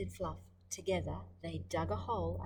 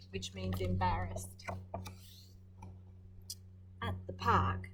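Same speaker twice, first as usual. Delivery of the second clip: ordinary delivery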